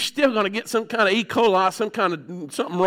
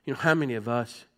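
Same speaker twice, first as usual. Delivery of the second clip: much softer, lower, pleading prayer; hushed prayer; 11 semitones lower, 10 dB quieter than usual. Real, not acted. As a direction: let it sink to a near-hush. Quiet reflective preaching.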